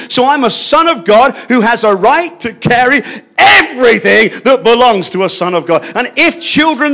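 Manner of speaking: a passionate preacher rising to a peak; fervent preaching. Real, not acted. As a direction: preach it at full force. Preaching at full force.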